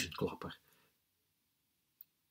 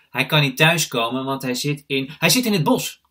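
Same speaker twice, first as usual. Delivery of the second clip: loud, hurried excuse, climbing higher; hasty blurt; 5 semitones higher, 12 dB louder than usual. A narrator's reading. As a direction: blurt out an answer, catching yourself midway. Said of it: "zeg ik vlug".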